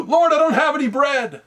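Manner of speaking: high, whiny mock plea; mock panicked cry. A believer whines to the Lord, high mock plea. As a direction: squeak it out like a helpless believer. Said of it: "what are you gonna do".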